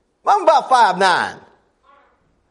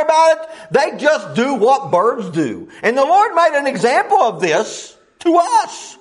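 higher, driving sermon voice; fiery preaching; 7 semitones higher than usual. Acted. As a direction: preach hard to the congregation. Preacher at full force.

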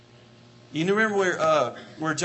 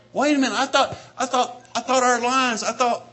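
loud, higher indignant protest; 5 semitones higher, 6 dB louder than usual. Pretend. Believer protesting to the Lord, indignant and loud. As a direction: sputter it like you were sure you were right. Mock voice of self-righteous believer.

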